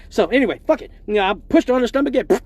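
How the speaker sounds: usual speaking voice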